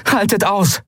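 high, urgent call. A narrator's reading. Captain encouraging the trapped crew, urgent calling. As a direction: call out to hold on. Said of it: "rief Endriel".

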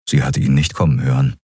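plain reading voice

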